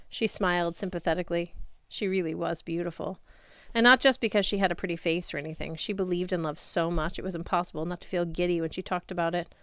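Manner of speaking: usual speaking voice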